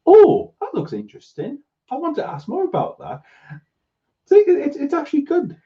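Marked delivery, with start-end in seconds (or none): 0.1-3.2 higher, mock eager curiosity; higher mock-interested viewer; mock curious. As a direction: play up the interest. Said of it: "you're just like".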